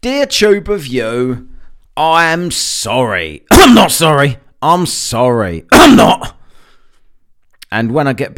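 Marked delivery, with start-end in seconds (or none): 0.0-3.5 slow mock-heartfelt sorry; fake apology. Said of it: "heartfelt apology". 3.5-4.4 loud coughed-out retraction; coughed outburst. Pretend. 4.6-5.6 slow mock-heartfelt sorry; fake apology. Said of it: "heartfelt apology". 5.7-6.4 high loud coughed retraction; coughed outburst. Pretend.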